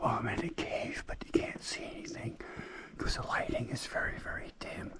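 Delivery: hushed whisper in a dark cave; cave echo. Real, not acted. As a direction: whisper so as not to disturb.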